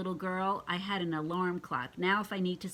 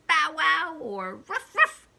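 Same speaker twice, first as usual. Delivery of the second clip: loud barking demonstration; dog barking; 8 semitones higher, 10 dB louder, slower than usual. Shown as a demonstration. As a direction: try out different dog barks. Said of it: "Is that what a dog says?".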